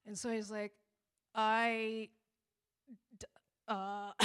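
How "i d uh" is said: halting, stuck false starts; trying to get it out. Retold or imitated. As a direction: act out struggling to start a sentence. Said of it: "trying to get it out".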